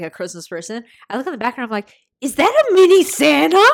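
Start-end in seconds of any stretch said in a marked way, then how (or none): 2.2-3.7 surprised. Retold or imitated. Spotting the Christmas decoration.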